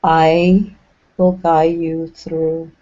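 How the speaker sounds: slow-paced meditation voice with long pauses between short phrases; even, measured channeled delivery, leaving lots of silence; guided meditation. Acted. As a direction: guide them gently inward, phrase by phrase.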